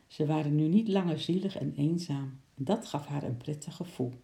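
usual speaking voice

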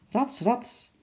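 climbing sound. A narrator's reading. Narrator sounds out Sjimp scrambling through the window, lively. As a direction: pop the sound of quick climbing.

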